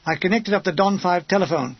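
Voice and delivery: plain reading voice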